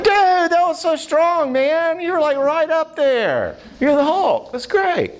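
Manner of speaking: very high, hyped-up cheering; squealing mock-amazed encouragement, sustained high; excited praise. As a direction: gush like an amazed buddy hyping up a kid. Retold cheering of a brave boy.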